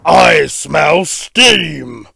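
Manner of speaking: loud, very slow giant voice; booming, slow-spoken giant; storybook giant. Acted. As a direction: draw it out loud, like a hunting giant. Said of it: "the giant made a loud sniffing noise".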